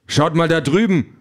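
urgent pointing-out shout; calling out. A narrator's reading. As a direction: call out to turn their heads. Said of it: "ruft er".

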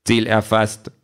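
monotone machine voice; tinny humourless rocket. A narrator's reading. Rocket reports to its owner, tinny and humourless. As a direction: report like a humourless metal robot. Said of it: "Mit ihrer blechernen, völlig humorlosen Stimme".

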